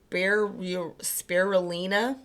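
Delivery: "spirulina" attempted haltingly, slow after a false start; struggling to pronounce. Real, not acted. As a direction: stumble on "spiru-", then slowly sound out "spirulina". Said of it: "I can't pronounce it".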